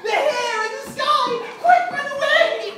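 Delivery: loud, high frightened yell; panicked shout. Acted. Boy yells at family to flee the pigs. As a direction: shout a warning in terror.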